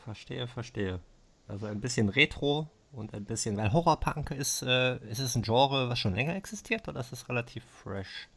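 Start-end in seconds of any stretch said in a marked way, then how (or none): none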